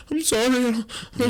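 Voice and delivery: very high, loud stammering panic; mock-terrified babble; freaking out. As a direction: squeal a hammed-up apology. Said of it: "I'm freaking out".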